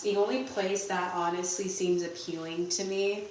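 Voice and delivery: ordinary talk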